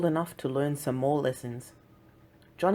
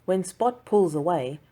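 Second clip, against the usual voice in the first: plain reading voice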